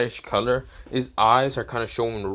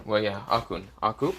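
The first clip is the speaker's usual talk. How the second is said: ordinary delivery, nothing marked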